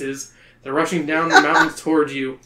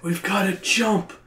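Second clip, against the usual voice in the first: a hint of a higher voice; lightly voiced you; 4 semitones higher than usual. You telling Patty and Brad to jump. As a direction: say it as yourself this time. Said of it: "That's the wrong voice".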